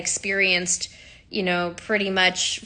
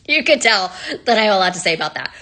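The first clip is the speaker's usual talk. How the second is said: quick laughing aside, amused at herself; 4 dB louder, faster than usual. Real, not acted. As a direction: laugh it off and rush the line. Self-aware aside about ranting.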